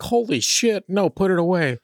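high squealing panic; mock horrified shriek. Adam recoils from the angel, high mock panic. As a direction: shriek it like a frightened man. Adam voiced reacting in horror.

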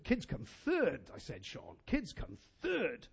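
slow, with THIRD held and falling; stress on third. Retold or imitated. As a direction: lean on "third", slowly.

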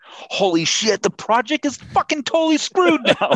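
very high, frantic mock alarm; panicking contractor. General contractor mocked as panicking at the homeowner, high and frantic. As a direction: shriek it like a contractor losing his nerve. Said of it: "want your general contractor to be like".

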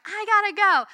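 high, loud hurried brush-off; frazzled mom. Retold or imitated. Mom to clingy daughters, rushed high exasperated cry. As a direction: shake off clingy kids, sharp and high.

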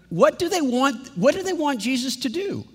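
ordinary talk

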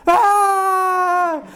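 high, loud mock wail of a toddler; hammed-up cry of a little girl; imitated child wail. Child wailing in tantrum.

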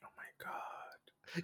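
breathless whisper; whispered oh my God. Real, not acted. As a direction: whisper it in disbelief. Reacting to accent idea.

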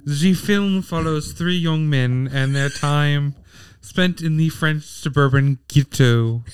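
put-on slow breathy French accent. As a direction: read it in a seductive French accent. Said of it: "Say it in a French accent".

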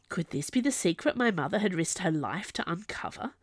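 ordinary delivery, nothing marked

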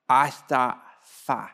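put-on "I thought fa" in slow baby talk, consonants dropped; toddler's missing consonants. Toddler son reporting to dad, "I thought fa" with consonants missing. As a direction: imitate a toddler, "I thought fa" in slow, mushy syllables. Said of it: "You need to pronounce your consonants".

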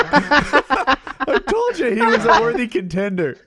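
high, loud words through real laughter; laughing hard. Real, not acted. Host gloating to friends after the clip, laughing hard. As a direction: crow it while cracking up.

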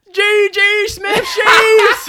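very high, drawn-out mock-Baltimore shout; Baltimore Steelers fan. Co-host imitating a Baltimore Steelers fan, shouted mock Baltimore accent. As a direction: yell like an exaggerated Baltimore fan. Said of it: "a Baltimore Steelers fan".

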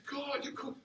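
high, hushed thrill; retold excitement. Young man newly hearing God, excited under his breath. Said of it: "But I got excited".

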